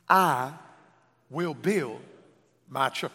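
loud, low, broken by pauses; emphatic call-and-repeat. Shown as a demonstration. Preacher leads congregation to say it with him, slow and emphatic. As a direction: punch I hard, then space out the rest.

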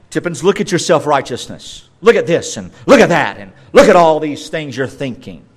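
loud, insistent mock scolding; mock nagging. Preacher imagines God pointing out faults, loud mock nagging. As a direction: hammer out each item as if nagging.

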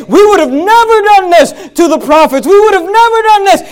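high, loud, hammed-up self-righteous voice; mock Pharisee boast. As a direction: lampoon their pious claim.

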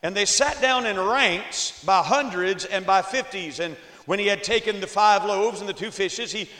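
loud, high, slow Bible reading; preaching scripture. Acted. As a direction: proclaim the verses to the church. Reads scripture aloud at force.

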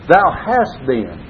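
"Thou hast been" drawn out slowly; slow emphasis. Shown as a demonstration. Preacher pointing out the phrase, slowly stressed. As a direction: linger on each word. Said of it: "Notice that".

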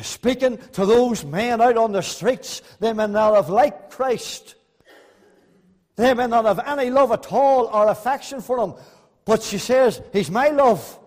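high, loud, slow pulpit delivery; full-force preaching. Acted. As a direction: preach it out over the congregation.

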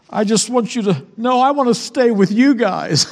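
high mock little girl voice, teary and earnest; hammed-up sobbing little girl. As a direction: voice the small daughter pleading to stay. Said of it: "she said".

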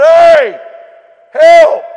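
loud, high cry for help from the pit; desperate holler; calling for help. Retold or imitated. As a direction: shout up for someone to hear.

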